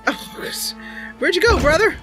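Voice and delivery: ordinary delivery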